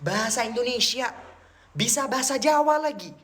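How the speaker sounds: high, incredulous exclaiming; amazed. Retold or imitated. Telling how the Indian man even speaks Javanese, amazed. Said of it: "Saya kaget".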